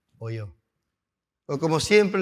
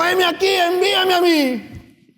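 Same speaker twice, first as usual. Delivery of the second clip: Isaiah's answer; 12 semitones higher, 5 dB louder than usual. Acted.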